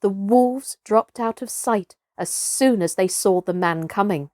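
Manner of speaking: ordinary delivery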